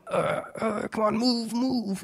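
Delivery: high, urgent pleading to his toe; willing the toe to move, strained; straining plea. Retold or imitated. To his toe in the clinic, urging it on, high and strained. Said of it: "I'm like".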